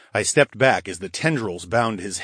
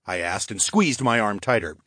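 ordinary delivery, nothing marked

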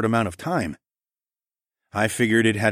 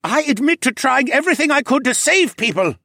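high, desperate creature plea; begging; 7 dB louder than usual. Acted. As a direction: beg them to believe you. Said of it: "Cadahy begged".

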